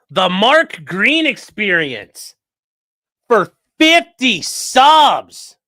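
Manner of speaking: slow, loud drawn-out announcement; hyped sub announcement, slow; announcer hype. Real, not acted. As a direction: boom it out like a host. Reading out a big donation.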